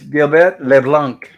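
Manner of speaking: put-on lightly French-accented name; Gilligan. Host reveals Gilligan's French sounding name, lightly French-accented. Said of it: "some French sounding name".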